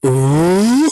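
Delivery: high, loud rising "ooh"; drawn-out high hoot; Moose's ooh. Acted. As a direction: let out a long acted "ooh". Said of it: "And Moose is just".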